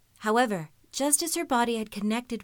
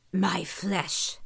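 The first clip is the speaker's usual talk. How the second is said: beast voice. A narrator's reading. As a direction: speak like a looming beast. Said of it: "it said".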